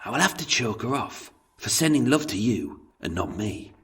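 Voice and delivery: soft, hauntingly dark jibe; hushed, menacing quip. Acted. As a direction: make the sinister joke quietly. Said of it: "in a hauntingly dark jibe, he quipped".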